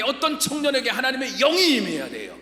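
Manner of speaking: ordinary delivery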